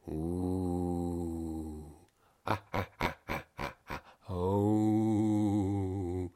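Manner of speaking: eerie monster wails; spooky monster noises. Acted. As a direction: moan like creatures in the dark. Strange noises in monster land.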